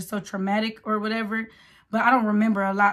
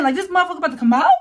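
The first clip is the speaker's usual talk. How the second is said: amazed disbelief; 7 semitones higher, 6 dB louder, much faster than usual. Retold or imitated. Disbelief at being ready to push.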